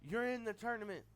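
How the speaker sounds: high, loud cocky boss voice; Ric Flair impression. Flair tells Hall, Nash and Steiner who's in, loud and high. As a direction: lord it over them as the president. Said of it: "He says".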